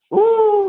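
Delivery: high, loud wincing ooh; pained ooh. Real, not acted. Fan bracing for the tough road ahead, high wincing ooh. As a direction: wince at the schedule ahead.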